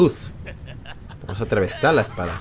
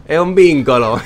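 louder, higher mock echo; amused exclaim; 5 semitones higher, 8 dB louder than usual. Real, not acted. Gamer mocking the game's bond line, louder and higher. Laughing at game dialogue.

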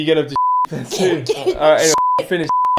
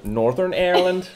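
higher-pitched Northern Irish accent; 5 semitones higher than usual. Shown as a demonstration. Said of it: "go on, do the difference".

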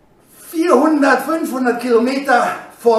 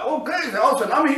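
quick, softer musing; muted self-appraisal; 3 semitones higher, 5 dB quieter, faster than usual. Retold or imitated. Athlete reassuring himself on stage, quick muted musing. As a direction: take the compliment in under your breath. Athlete's own reaction to the shout.

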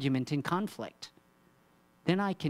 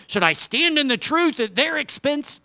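high, loud, hammed-up self-righteous ask; mock righteous question; 14 semitones higher, 11 dB louder than usual. Preacher mocking a self-righteous churchgoer, hammed-up. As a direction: ham up the self-righteous objector. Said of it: "Instead of".

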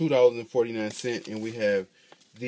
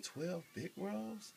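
soft, slow, unsure reading with a rising question; a hesitant stranger squinting at the sign; puzzled bystander; 8 semitones higher, 18 dB quieter, much slower than usual. Retold or imitated. Bystander asked to read the sign, soft unsure mumble.

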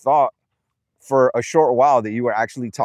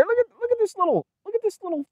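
very high pet-talk voice; squeaky high fussing; fussing over an animal; 4 dB quieter, faster than usual. Real, not acted. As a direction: pitch way up, exclaiming at a little goat. Fussing over the goat up there.

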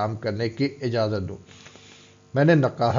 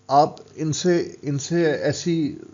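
faltering; 4 semitones higher, much slower than usual. A narrator's reading. Jani falters, stops mid-sentence.

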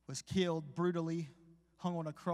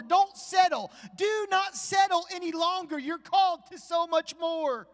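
building from raised to full high, loud cry; preaching at full force; 10 semitones higher, 9 dB louder than usual. Acted. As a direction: drive the appeal home, louder and higher. Sermon climax builds.

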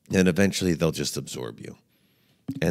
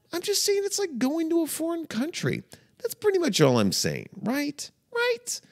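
high-pitched pleading. Real, not acted. Emphatic rising pleas.

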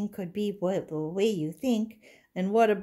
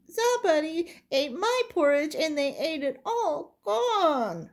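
high, whiny little bear voice; Baby Bear upset that his porridge is gone, in a high little voice; 10 semitones higher than usual. Acted. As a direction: pitch up into a small cub wailing. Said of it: "And Baby Bear said".

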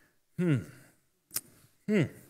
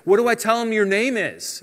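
higher-pitched acted question; mock-worried, raised pitch; pleading excuse; 8 semitones higher, 3 dB louder than usual. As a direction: voice a reluctant prophet asking upward. Acts Moses making excuses to God.

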